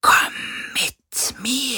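a phantom drawing him in; ghostly hissed summons; eerie beckoning. A narrator's reading.

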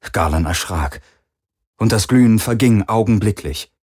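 ordinary delivery, nothing marked

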